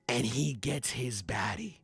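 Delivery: whispered softly. Real, not acted. Telling the reunion to his co-hosts, whispered. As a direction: lean in and whisper it.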